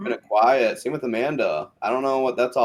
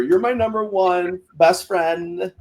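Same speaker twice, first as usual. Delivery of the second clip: high, drawn-out fake weepy voice; hammed-up sobby gushing; mock tearful; 8 semitones higher, slower than usual. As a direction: imitate a weepy best friend, slow and high. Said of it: "they're crying, hugging".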